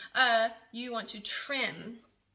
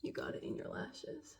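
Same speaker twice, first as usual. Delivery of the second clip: whispered, lower and much softer; hushed horror; 6 semitones lower, 11 dB quieter than usual. Real, not acted. To viewers, a whispered dread. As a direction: breathe it out like a disaster. Horror at eyelash wax mishap.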